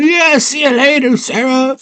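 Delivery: high, light goodbye while fleeing; cheeky Hoggle. Retold or imitated. Hoggle runs off and waves Sarah goodbye, squeaky high. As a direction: toss off the farewell in a squeaky voice.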